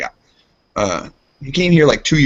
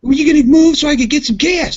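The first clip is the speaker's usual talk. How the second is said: high, louder imitation of a honking driver; imitated impatient demand; 5 dB louder than usual. Lady waiting for the gas pump, imitated impatient demand.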